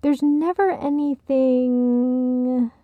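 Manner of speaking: groping for the next word on a long held sound; searching for words. A narrator's reading. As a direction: stretch the final word, leaving it unfinished. Searching for a word, Ted supplies it.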